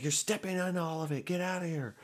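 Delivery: high, strained mock yell; imitated angry yell. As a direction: mimic a frantic friend shooing you off. D pushes him away, retold.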